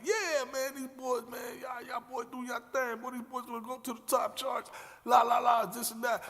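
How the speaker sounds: high-pitched hyped praise; big up the new crew; impressed praise. Retold or imitated. [name] praising the boys after their set, high hyped voice. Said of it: "they were like".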